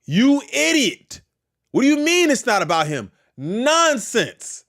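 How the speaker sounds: a hint of an outraged mother telling someone off; scolding mom. Mom talking to you about nonsense, mildly indignant scolding. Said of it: "That's like your mom talking to you".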